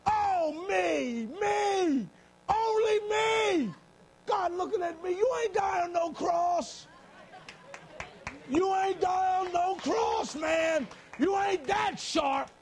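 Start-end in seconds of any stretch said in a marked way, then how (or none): 0.1-3.7 much higher, louder, slow whine of only me; a loud high drawn-out mock lament; self-pitying wail. Mocking his own self-pity. 5.1-12.5 slow, louder, much higher booming reprimand; a slow shouted scolding from God; God's loud rebuke. Acted.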